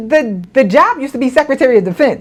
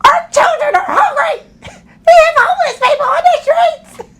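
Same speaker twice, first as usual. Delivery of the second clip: high, laughing, shouted outrage; mock outraged senators; 13 semitones higher than usual. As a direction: ham up a grandstanding senator. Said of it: "And they were like".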